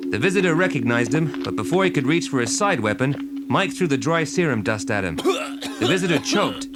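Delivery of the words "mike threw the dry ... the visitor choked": ordinary delivery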